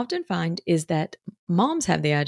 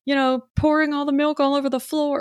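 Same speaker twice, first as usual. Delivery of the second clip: mock a guilt-ridden apology; guilty apologetic mom; 8 semitones higher than usual. Guilty mom apologizing to her kid, high and pleading. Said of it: "wants to be like".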